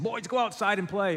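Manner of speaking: put-on high-pitched mom sending kids out; mock motherly call to the boys; imitated mom. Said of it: "complain to my mom when she said".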